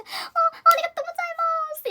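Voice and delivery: high, drawn-out Japanese thank-you; gushing thanks. Retold or imitated. Thanking the stranger who buttoned the poncho, high-pitched gushing. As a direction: stretch a grateful squeak upward.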